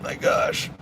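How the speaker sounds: breathed out with no voice at all; voiceless murmur of dismay; voice gone. Real, not acted. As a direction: push out air, no voice left. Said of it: "I think I'm losing my voice".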